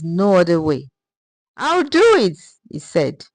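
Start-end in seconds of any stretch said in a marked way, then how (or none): 1.6-2.4 lightly voiced determination, raised; a touch of young resolve; mild bright resolve in the voice; determined. A narrator's reading. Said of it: "his voice filled with determination".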